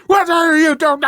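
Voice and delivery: high, loud angry shout; loud high mock outrage; mock yell. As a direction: yell it at the intruder. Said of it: "came out yelling and screaming like".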